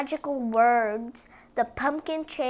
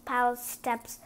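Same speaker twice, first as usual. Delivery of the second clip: usual speaking voice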